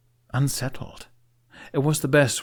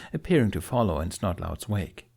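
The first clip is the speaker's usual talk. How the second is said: plain reading voice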